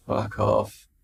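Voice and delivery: whispered sleepy curse; hushed groggy swear; brain grumbling. Pretend. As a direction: mutter it barely awake. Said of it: "says my brain".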